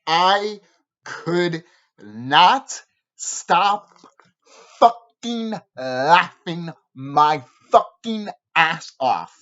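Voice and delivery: every word drawn out very slowly; emphatic, word by word. Real, not acted. Telling viewers how hard he laughed, slowly and emphatically. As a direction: hammer each word separately with long gaps.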